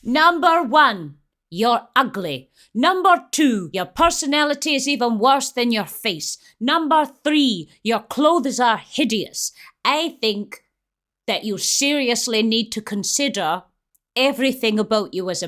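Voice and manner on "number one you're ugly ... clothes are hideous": high, loud count before each insult; emphatic listed insults. Pretend. Counts out insults to film villain.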